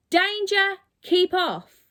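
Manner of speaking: usual speaking voice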